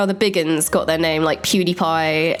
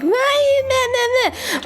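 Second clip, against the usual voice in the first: high nasal-free "meh" mockery, much higher; mocking whine. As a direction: mimic a whiny bleat, pitched way up. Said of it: "statements about your voice before".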